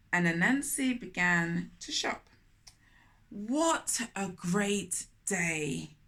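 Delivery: put-on storytelling voice for children; gentle read-aloud storytelling; story narration. A narrator's reading. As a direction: read it like a picture book aloud. Narrating Anansi shopping.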